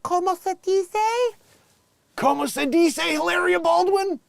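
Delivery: very high, mocking Spanish-accented voice; exaggerated fake Spanish accent, silly and squeaky; put-on Spanish accent. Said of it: "it's her stu".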